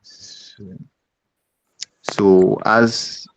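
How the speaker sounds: garbled, dropping out; half-lost words on a bad connection; scrambled voice. Real, not acted. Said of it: "I can't hear you".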